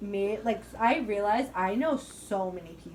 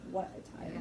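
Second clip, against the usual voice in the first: whispered; 3 semitones lower, 12 dB quieter than usual. Shown as a demonstration.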